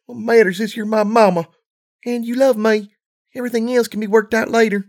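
put-on high, young filly voice; a small child reassuring her mother; little filly voice. A narrator's reading.

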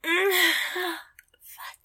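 ordinary talk, nothing marked

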